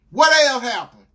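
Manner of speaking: dead serious. Retold or imitated. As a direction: burst out demanding the rest. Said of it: "dead serious".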